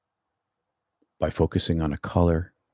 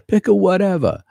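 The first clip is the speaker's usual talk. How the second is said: much higher, louder mock New York accent; 13 semitones higher, 6 dB louder than usual. Shown as a demonstration. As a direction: ham up a New York street voice. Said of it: "I'm trying to do a New York accent".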